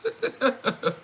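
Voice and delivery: high laugh after the rant; laughing at herself. Real, not acted. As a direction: burst into laughter. Laughing at her own rant.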